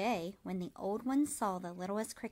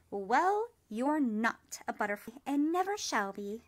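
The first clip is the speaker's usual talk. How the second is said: firm; 3 semitones higher, 8 dB louder than usual. A narrator's reading. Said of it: "the dragonfly said firmly".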